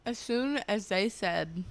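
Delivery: talking around a mouthful of ice; words slurred by ice in mouth. Real, not acted. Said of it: "sorry, I got ice in my mouth".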